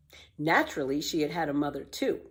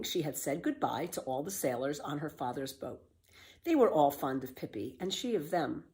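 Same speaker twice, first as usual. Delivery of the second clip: ordinary delivery, nothing marked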